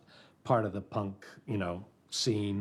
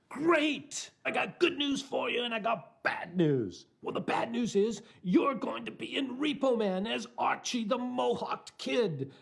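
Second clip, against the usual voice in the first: much higher, put-on English director voice; mock English director; 13 semitones higher than usual. Director approving the actor, high mock English voice. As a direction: squeak it like the eccentric director. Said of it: "He said".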